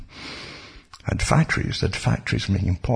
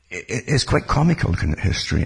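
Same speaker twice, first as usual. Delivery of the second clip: ordinary talk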